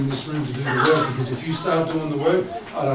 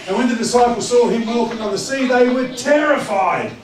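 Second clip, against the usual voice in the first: loud, high dramatic Bible reading; scripture reading; 7 semitones higher, 5 dB louder than usual. Acted. Preacher reads the disciples' sighting to his congregation, loud and dramatic.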